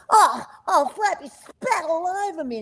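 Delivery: high, bright koala squeal of surprise; Blinky koala voice. Retold or imitated. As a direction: squeal it as little Blinky, spattered. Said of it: "where Blinky would go".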